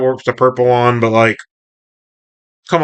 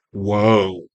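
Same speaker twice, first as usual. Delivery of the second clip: lower, drawn-out mock whoa; low-key amazement; 5 semitones lower, 4 dB quieter than usual. Viewer reacting to the scene, understated low whoa. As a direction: let it fall away under your breath. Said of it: "And I'm like".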